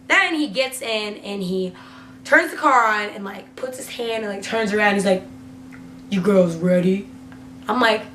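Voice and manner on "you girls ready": low voice imitating a man; lightly voiced creepy cabbie, pitched down; retold creepy driver.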